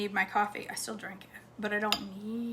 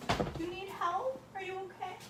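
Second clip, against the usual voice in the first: pet voice; 10 semitones higher, 7 dB quieter than usual. Real, not acted. Fussing over the old dog.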